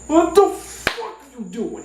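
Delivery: high, drawn-out disbelief, dropping off; appalled cousin. Retold or imitated. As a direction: cry out at what you caught him doing. Cousin catches him eating rocks.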